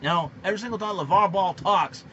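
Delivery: ordinary talk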